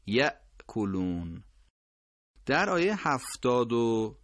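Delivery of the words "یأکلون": یأکلون with a clear glottal stop; corrected recitation; sharp hamza. Shown as a demonstration.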